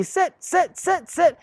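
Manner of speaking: high, sing-song repeated command; mock owner nagging. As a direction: parody a clueless owner chanting at the puppy. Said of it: "they tell the puppy".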